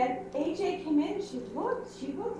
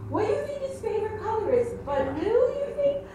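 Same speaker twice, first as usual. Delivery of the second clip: put-on little girl voice; 9 semitones higher, 3 dB louder, faster than usual. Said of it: "She goes".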